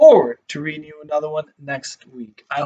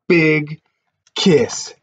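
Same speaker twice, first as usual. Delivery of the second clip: usual speaking voice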